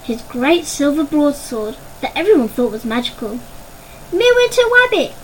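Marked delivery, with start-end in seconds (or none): 4.1-5.1 put-on very high baby squeal, lisping wabbit for rabbit; baby shouting. Puke wriggling in his brother's arms, excited baby squeal. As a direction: call Midwinter Wabbit like an excited toddler. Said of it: "Puke shouted".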